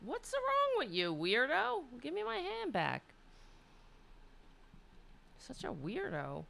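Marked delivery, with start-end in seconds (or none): 0.0-3.0 much higher squeaky cat voice; high pretend cat voice asking for his hand back; cat's voice. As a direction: voice the cat as if he's baffled. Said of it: "He's all".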